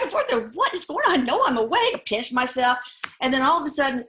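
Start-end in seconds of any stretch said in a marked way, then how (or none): 0.5-1.9 much higher, disbelieving cry; retold stunned disbelief; astonished. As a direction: blurt it out as if you can't trust your eyes. Mother seeing ghost son.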